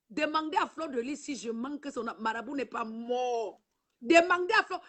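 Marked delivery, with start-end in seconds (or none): 4.0-4.8 raised challenge. Real, not acted. Défie ses ennemies en direct.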